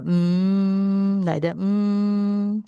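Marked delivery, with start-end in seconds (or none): 0.0-1.2 a steady humming Mm to feel the vibration; nasal hum. Shown as a demonstration. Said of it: "feel some vibration here". 1.6-2.6 a steady humming Mm to feel the vibration; nasal hum. Shown as a demonstration. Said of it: "So nasal sound".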